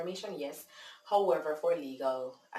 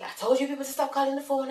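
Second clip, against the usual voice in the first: fast, high, louder fed-up outburst; upset; 10 semitones higher, 6 dB louder, faster than usual. Retold or imitated. Upset man telling callers to stop, imitated irritation. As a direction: mimic an irritated man, rattling it off. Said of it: "And so he's upset".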